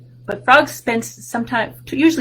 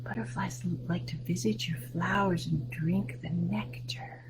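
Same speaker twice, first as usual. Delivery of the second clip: much softer whispered line; 2 semitones lower, 14 dB quieter than usual. Shown as a demonstration. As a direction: whisper it to the children. Repeats sentence in whisper.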